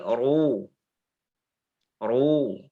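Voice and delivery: ru thick; heavy ru. Shown as a demonstration. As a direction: say ru full and heavy. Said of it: "Tapi kalau tebal".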